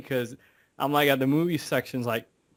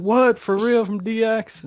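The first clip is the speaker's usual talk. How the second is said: high, loud burst of surprised questions; excited, incredulous retold reaction; amazed disbelief; 10 semitones higher, 5 dB louder than usual. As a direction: imitate a friend who can't believe the news. Friend's amazed reaction acted out.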